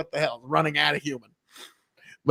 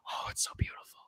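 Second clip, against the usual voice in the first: hushed, breathless whisper of wonder; awed whisper; 10 semitones lower, 18 dB quieter, faster than usual. Retold or imitated. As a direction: whisper it like seeing a deer for the first time. Awed first sight of deer.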